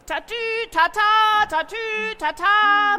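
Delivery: high, loud siren wail tatü tata; siren imitation. Narrator imitating the police car's siren, high and loud. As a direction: sing out like a police car siren.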